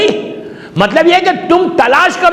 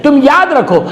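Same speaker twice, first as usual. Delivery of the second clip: ordinary talk, nothing marked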